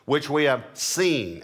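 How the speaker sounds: "seen" drawn out, strong and falling; slow the phrase and lean on "seen"; stress on seen. Shown as a demonstration. Preacher underlining a word as he reads, stress on "seen". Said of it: "I underline the word seen".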